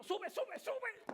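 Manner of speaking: high, urgent repeated cry; panicked urging, pitched high; father urging his son to roll it up. Retold or imitated. Urgently telling son to close window.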